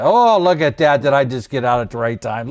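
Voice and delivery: high, loud, acted amazement; mock delight. Trader seeing he got out just in time, mock delighted. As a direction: crow over a lucky exit.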